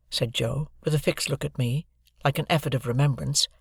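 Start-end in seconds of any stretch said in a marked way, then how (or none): none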